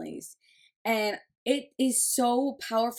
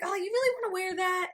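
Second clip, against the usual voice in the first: high, fast, fake-sweet doubt; mother questioning her son's clothes, high and quick; mock doubtful mom; 9 semitones higher, faster than usual. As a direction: needle him gently about the outfit.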